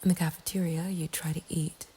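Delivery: plain reading voice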